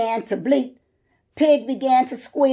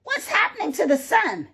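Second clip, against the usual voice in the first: panicky pig; 7 semitones higher, faster than usual. Acted. Pig panicking at vanishing sun.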